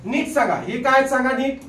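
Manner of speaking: normal talking voice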